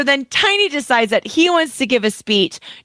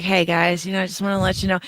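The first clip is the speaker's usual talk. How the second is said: lightly voiced Tiny, lower and softer; imitating Tiny; 6 semitones lower, 5 dB quieter, faster than usual. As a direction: toss it off, laid back and lazy. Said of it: "and he's like".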